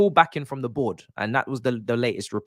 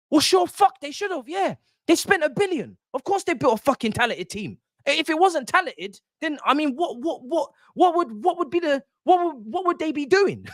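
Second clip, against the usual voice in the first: very high-pitched incredulous rant; exasperated disbelief. Real, not acted. Streamer rebutting fans who praise the squad, exasperated. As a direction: voice shooting up in exasperation.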